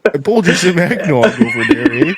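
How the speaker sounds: high apologetic Canadian voice; mock Canadian host. Pouring eggnog on guest, mock Canadian apology. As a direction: play an overly sorry Canadian.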